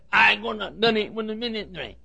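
mushy garbled ministry as minimi; mock tongue-tied. Preacher mimicking Joe's garbled speech, light mockery. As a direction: blur the consonants like Joe. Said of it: "can't even talk plainly".

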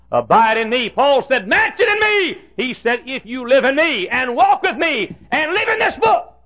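high, loud revival preaching; full-force preaching. Acted. Preacher rousing the congregation, in loud high preaching.

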